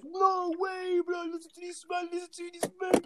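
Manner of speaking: high, excited put-on voice; mocking lads. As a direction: imitate mates marvelling at posh words. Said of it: "they'll be like".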